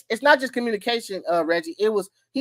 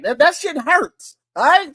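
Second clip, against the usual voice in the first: ordinary delivery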